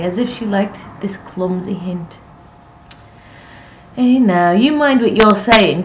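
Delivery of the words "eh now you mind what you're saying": loud, raised admonishment; much louder, pitched-up reproof; crying out. A narrator's reading. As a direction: call out a warning. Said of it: "cried Anne".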